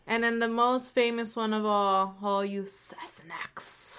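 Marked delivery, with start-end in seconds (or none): 2.2-4.0 slow whispered Scottish Sassenachs with a sharp snap on the end; Jamie's Scottish whisper. Retold or imitated. Imitating Jamie Fraser for Outlander fans, slow Scottish whisper. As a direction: breathe it like Jamie Fraser, snapping the final k. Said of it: "you have to say it with the snap at the end".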